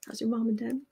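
softly attempted Southern accent; Sarah's Southern accent. Imitating a friend's folksy greeting, tentative Southern accent. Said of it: "she can do this great Southern accent".

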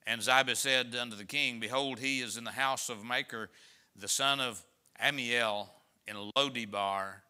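solemn pulpit reading; scripture reading. Acted. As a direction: read the verse out to the congregation. Reads scripture aloud.

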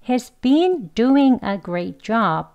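He's been doing a great job, been and doing said fully and slowly; strong form sentence. Shown as a demonstration. Teacher modelling the full careful sentence. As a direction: spell out every word slowly. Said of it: "강형입니다".

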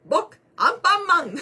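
high, loud, slow cartoon hero voice; Anpanman impression. 視聴者に頼まれてアンパンマンを、高く大きくゆっくり. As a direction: stretch it out like Anpanman. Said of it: "似てない".

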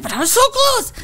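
high, comic lament; retold anguished wail. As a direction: wail over the near miss, hammed-up. Retold lament after failed audition.

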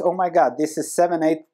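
usual speaking voice

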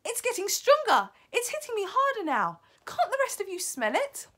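high, loud, urgent witch; excited alarm. Acted. Witch Mildred asking the rest to smell, urgently excited. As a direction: urge the others on in a high voice.

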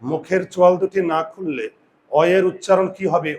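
normal talking voice

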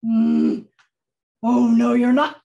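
loud, growling troll refusal; loud, menacing storybook troll. Acted. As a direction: boom it out like a mean troll. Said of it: "said the troll".

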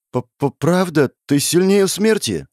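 awed, still unsteady question; voice steadying. A narrator's reading. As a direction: stutter at first, then ask in timid wonder. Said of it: "Его голос слегка выровнялся".

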